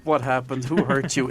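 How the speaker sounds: normal talking voice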